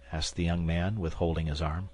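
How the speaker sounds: ordinary delivery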